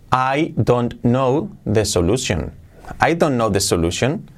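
"I don't know the solution" word by word, every word separate; slow, fully pronounced "I don't know the solution"; textbook English. Shown as a demonstration. Teacher shows the learned version of "I don't know the solution", textbook English. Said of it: "la hemos aprendido".

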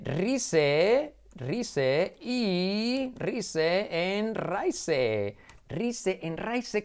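"Rise" and "Raise" read letter by letter in Spanish; Spanish spelling pronunciation. Shown as a demonstration. Teacher jokes how easy Spanish reading is, Spanish pronunciation. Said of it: "lo fácil que es pronunciar en español".

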